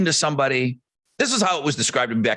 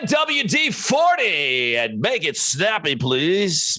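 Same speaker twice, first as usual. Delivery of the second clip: mock on-air voice at the oil change; exaggerated radio voice; 6 semitones higher, slower than usual. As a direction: push it like an over-the-top DJ. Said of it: "not talking like I do on the radio".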